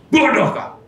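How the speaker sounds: mild mock rebuke of a child; harsh scolding. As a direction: snap it at a stubborn child. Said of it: "nadanya itu keras".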